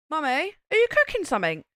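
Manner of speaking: high, loud mock child voice; imitating young son. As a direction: play an eager little kid asking mum. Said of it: "my son goes".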